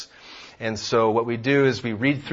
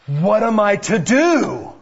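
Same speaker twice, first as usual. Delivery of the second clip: dramatic plea; 7 semitones higher, 7 dB louder than usual. Retold or imitated. Father asks kids dramatic question.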